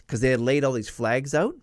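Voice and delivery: ordinary talk, nothing marked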